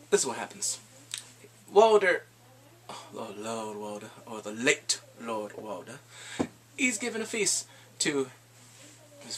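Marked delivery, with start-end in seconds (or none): none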